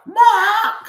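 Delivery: very high, loud mock squawk; bird squawk. Imitating the cartoon teacher yelling, bird-like squawk. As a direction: screech it like a startled bird. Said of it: "Sounds like a bird".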